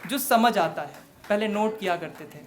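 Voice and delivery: projected stage recitation; poetry recital. Acted. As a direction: launch the verse strongly, then ease off. Recites own poem.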